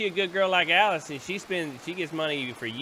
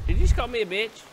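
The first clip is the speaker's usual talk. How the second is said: louder, higher indignant question; mock offended; 7 semitones higher, 6 dB louder than usual. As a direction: act shocked and offended.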